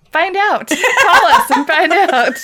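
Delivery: high, loud, through real laughter; laughing tease. Real, not acted. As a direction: crack up while daring them to try. Cracking up during joking tease.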